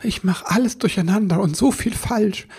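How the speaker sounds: high small-elf voice; dejected little elf. Acted. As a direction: own up to being a muddler. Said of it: "meinte der Wichtel".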